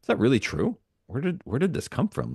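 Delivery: high, doubting inner voice asking itself; incredulous self-questioning. Retold or imitated. Coach voicing her inner questions about old beliefs, high and incredulous. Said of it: "is like".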